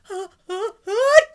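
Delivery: loud high gasping breath; sneeze gasp. Acted. Wolf gasping as the sneeze comes on, loud. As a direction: suck in air before a sneeze.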